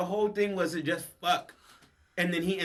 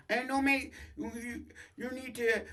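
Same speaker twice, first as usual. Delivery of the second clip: high-pitched, stammering mock voice; hesitant imitation; 9 semitones higher, 5 dB quieter than usual. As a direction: imitate someone stumbling nervously over the question. Acting out a nervous character.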